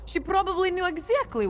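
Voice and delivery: excited. Acted. Eager to join the quest.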